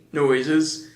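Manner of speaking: ordinary delivery, nothing marked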